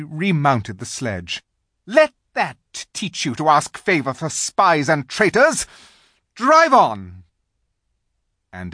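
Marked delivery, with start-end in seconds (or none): 1.9-7.2 high, loud contemptuous order; witch's command. A narrator's reading. Witch demanding the silent animals answer. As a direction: spit the insult down at them. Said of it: "said the witch".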